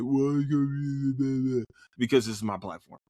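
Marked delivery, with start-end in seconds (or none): 0.0-1.6 drawn-out, higher mock whine; whiny naysayer imitation, words slurred together; mock complaint. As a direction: imitate critics complaining, stretching it out into a drone. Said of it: "everybody will be like".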